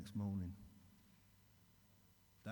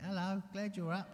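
higher, mock-sweet voice of the illness; sinister greeting; 7 semitones higher, 8 dB louder than usual. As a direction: greet him like a lurking tormentor. Illness greeting him at bedside.

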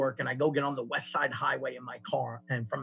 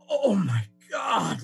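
stunned realization; 5 semitones higher, 4 dB louder, much slower than usual. Retold or imitated. Sudden realization while chasing driver.

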